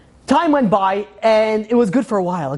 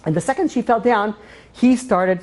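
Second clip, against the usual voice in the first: ordinary talk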